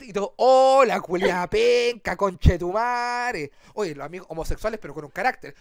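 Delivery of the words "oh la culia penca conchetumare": loud slow drawn-out insult; slow, loud mocking curse; mock crowd insult. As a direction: jeer like a booing crowd.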